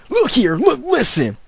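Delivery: high, loud mocking catchphrase; exaggerated imitation for laughs; Wings of Redemption. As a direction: push it up high like a teasing impression. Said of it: "when he says".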